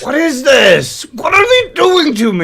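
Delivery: high squeaking twitchy voice; squeaky rat voice. Acted. Mock outraged reaction to clip.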